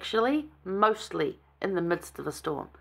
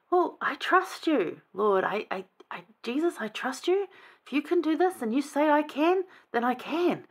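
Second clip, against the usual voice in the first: a lightly voiced Peter, trusting and hopeful; earnest Peter; 8 semitones higher than usual. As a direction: Peter begging Jesus to let him try, higher and earnest. Voicing Peter asking to walk.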